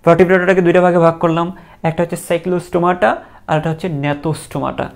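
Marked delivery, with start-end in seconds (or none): none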